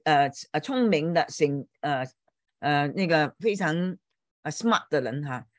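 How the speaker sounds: normal talking voice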